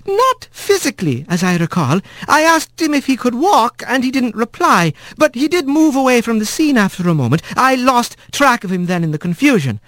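high polite nurse wren; eager little bird witness. A narrator's reading. Wren testifies.

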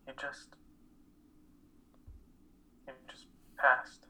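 slow, halting words of shock; barely getting the words out; stunned grief. Acted. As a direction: let the news sink in as you speak. Learning on the phone of a death.

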